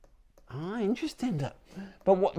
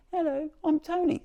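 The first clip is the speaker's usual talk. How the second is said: high, tiny talking-key voice; Tony, little voice; 11 semitones higher, 4 dB quieter than usual. Acted. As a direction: pipe up like a little gadget calling out its name. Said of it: "it stopped saying".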